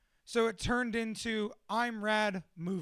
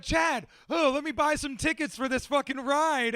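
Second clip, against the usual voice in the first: higher, louder call-out; eager shout; 5 semitones higher, 4 dB louder than usual. Retold or imitated. Telling Chad he'll buy tickets, eager shout.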